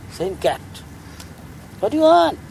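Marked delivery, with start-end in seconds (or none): 1.8-2.4 high, louder sage voice asking. Acted. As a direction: pitch it up as the sage. Sage asks the cat.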